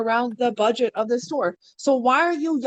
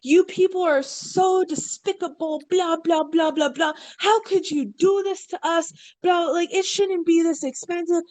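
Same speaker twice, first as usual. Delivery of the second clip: higher whiny rant, imitating an entitled customer; mocking an angry customer; 4 semitones higher than usual. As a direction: ham up a complaining shopper's tirade, a bit higher. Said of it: "this woman is going off".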